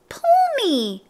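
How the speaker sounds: tiny, very high tempting voice; high-pitched talking pigtail, coaxing; little pigtail voice. A narrator's reading. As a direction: beg in a little squeaky voice. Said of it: "said the pigtail".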